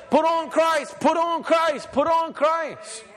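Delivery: high, loud repeated call, Put on Christ; fervent shouting preacher; preaching shout. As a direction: drive it home, last one slowing.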